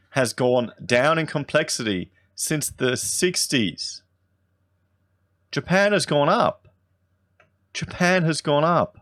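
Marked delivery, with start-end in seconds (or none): 5.5-9.0 high-pitched, emphatic disbelief; astonished. Real, not acted. Vlogger sees Japan rose while Australia fell, astonished. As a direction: repeat it higher, as if stunned.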